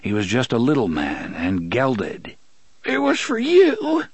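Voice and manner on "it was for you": high, strained sobbing plea; broken man sobbing out his love to Ali. Acted. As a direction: beg through sobs in a high voice. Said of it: "Sheb sobbed".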